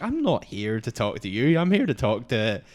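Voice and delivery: retold brush-off. Brushing off the other guy distracting her, mock-important.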